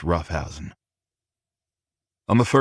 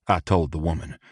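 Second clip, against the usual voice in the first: plain reading voice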